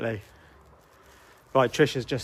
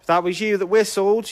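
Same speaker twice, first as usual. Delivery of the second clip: high, loud call to the spirit; talking to a ghost; 9 semitones higher, 6 dB louder than usual. Real, not acted. Calling out to a spirit.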